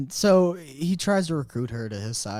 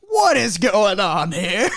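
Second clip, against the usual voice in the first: slow, louder, higher villain demand with a laugh; mock villain; 5 semitones higher, 5 dB louder, slower than usual. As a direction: drag out the menacing question, laughing. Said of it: "and he goes".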